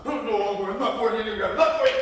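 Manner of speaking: sulky refusal. Acted. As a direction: stamp your foot and refuse. Buddy refuses the errand.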